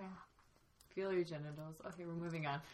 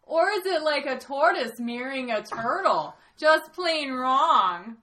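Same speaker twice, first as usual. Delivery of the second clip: louder hammed-up Minneapolis Myanmar accent, ending drawn out; 3 semitones higher, 5 dB louder, slower than usual. Panelist rereads on request in a mock Minneapolis Myanmar accent. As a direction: Myanmar by way of Minneapolis, last words stretched slow and loud. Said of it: "Minneapolis Myanmar accent".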